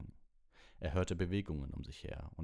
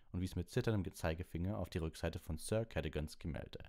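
ordinary delivery, nothing marked

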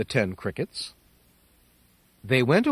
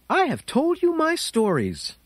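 higher little mouse voice; a hint of a clever small mouse; 13 semitones higher than usual. Mouse voice held.

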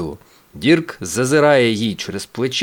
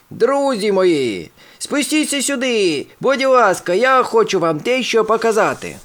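calling out; 9 semitones higher than usual. A narrator's reading. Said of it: "і кричить".

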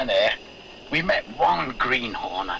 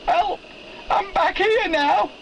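high, slower trapped-man call; voice calling for help; slower than usual. Retold or imitated. Miner retelling the voice now behind the coal pile. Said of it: "And the voice now said".

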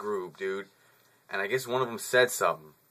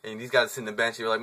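usual speaking voice

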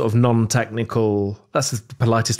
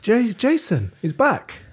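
excited; 11 semitones higher, slower than usual. Real, not acted. Delighted at return.

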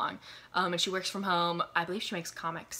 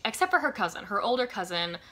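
normal talking voice

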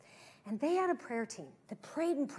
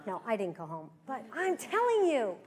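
normal talking voice

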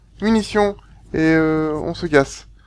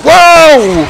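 high loud waouh; startled wow; 11 semitones higher, 9 dB louder than usual. Real, not acted. Gamer jolted by the scene, loud startled waouh. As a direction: let out a shocked waouh. Surprised by game event.

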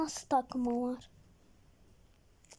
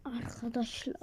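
hushed whisper to the audience; a soft, secretive whisper; 5 semitones lower, 8 dB quieter than usual. Real, not acted. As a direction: lean in and whisper it. Whispers to the viewers.

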